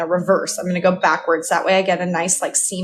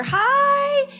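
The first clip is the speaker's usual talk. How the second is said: high, loud squealed hi; excited greeting; 6 dB louder than usual. Real, not acted. Streamer greets a viewer who just tuned in, excitedly. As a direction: burst into a high-pitched hello.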